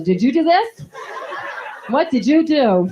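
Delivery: high, loud scolding, slow; mock scolding. Dog mocking the owner's scolding, loud and high. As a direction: parrot the owner's scolding back.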